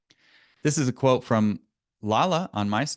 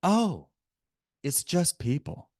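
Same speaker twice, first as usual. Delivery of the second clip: higher-pitched "oh" of realization; retold realization; 9 semitones higher than usual. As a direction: act out someone else's small epiphany. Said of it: "he realized".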